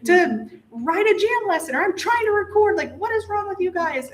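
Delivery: imitating her own frazzled shout; retold mom yell. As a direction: replay yelling at the kids from the studio. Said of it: "I'm screaming out the door".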